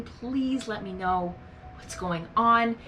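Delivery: ordinary talk, nothing marked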